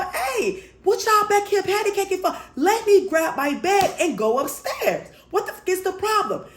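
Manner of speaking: retold high-pitched holler through a door; exasperated, high-pitched mock holler; indignant calling out. Calling through door while knocking.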